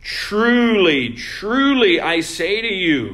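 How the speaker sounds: forceful sermon proclamation; preaching proclamation. Acted. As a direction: declare it to the congregation. Proclaims Jesus's solemn words.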